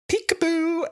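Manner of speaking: very high sing-song peekaboo; playful peekaboo. Retold or imitated. Cassandra teasing Rose, very high and playful. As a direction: pop up teasingly, squeaky high. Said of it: "Cassandra goes".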